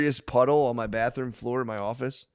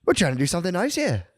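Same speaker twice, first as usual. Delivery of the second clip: put-on Australian accent; 5 semitones higher than usual. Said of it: "Is that your Australian accent?".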